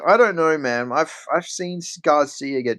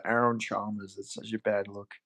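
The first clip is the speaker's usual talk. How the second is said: normal talking voice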